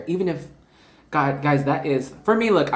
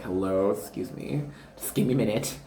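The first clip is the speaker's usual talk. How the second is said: mock interruption; 6 semitones lower, 6 dB quieter than usual. Cutting away an energy, mock interruption. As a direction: lightly act out asking for a minute.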